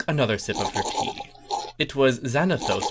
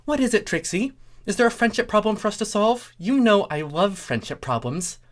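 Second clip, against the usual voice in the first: Starlight voice; 10 semitones higher than usual. Acted. Starlight eager character voice.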